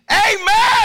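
very loud, high, drawn-out Amen; full-throated church Amen; congregation shout. Shown as a demonstration. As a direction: belt it out from the pews. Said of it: "number four is".

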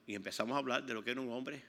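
ordinary delivery

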